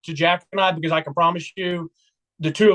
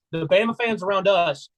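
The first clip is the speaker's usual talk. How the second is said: ordinary talk, nothing marked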